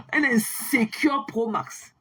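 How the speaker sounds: drawn out slowly, sneering; slow mocking taunt. Mocking a rival on her live, slow taunt. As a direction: spell out the insult slowly to belittle him.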